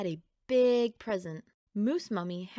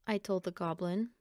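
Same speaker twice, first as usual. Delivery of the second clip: plain reading voice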